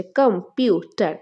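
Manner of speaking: Computer said slowly, syllable by syllable; slow syllable demo. Shown as a demonstration. Teacher shows students the syllables, slow and clear. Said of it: "এখান থেকে কোথায় stress পড়ছে?".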